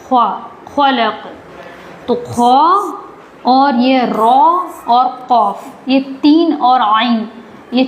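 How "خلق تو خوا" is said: ordinary talk, nothing marked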